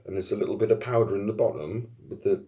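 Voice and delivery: normal talking voice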